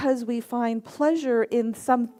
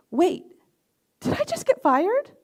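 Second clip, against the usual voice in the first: high-pitched, baffled question; sudden puzzled double take; acted-out startled disbelief; delayed realization; 8 semitones higher than usual. Delayed realization after boss's call.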